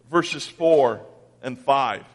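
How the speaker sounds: ordinary delivery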